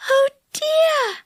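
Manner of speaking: high, worried fairy sigh; a little cry of dismay; Silky dismayed. A narrator's reading.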